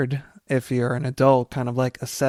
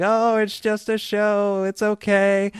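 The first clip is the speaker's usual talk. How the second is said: higher, amused motherly voice; mocking mom reassurance; 7 semitones higher than usual. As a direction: imitate mom brushing it off while laughing. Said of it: "my mother laughing at me".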